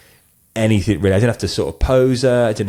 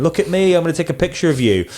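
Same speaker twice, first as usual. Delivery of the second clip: high-pitched mock pestering photographer; pushy photographer; 9 semitones higher than usual. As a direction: mimic someone shoving a camera at people, high and quick. Mimics an intrusive photographer.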